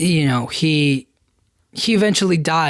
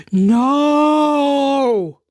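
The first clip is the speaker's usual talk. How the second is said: high, loud mock shout of no; big powerful no; 9 semitones higher, 3 dB louder than usual. Caesar shouting no at Tom Felton. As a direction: belt out one huge no. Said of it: "does that big, powerful no".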